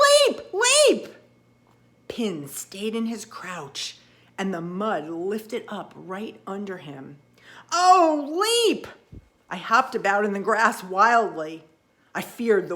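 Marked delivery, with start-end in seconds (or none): 0.1-1.1 very high, loud panicked cry; frantic shout. Acted. Frog warns Pin of the turtle, frantic shout. 7.7-8.9 high, loud desperate cry; panicked yell. Acted. Frog panics as the turtle rises, loud yell. Panicked warning as mud lifts.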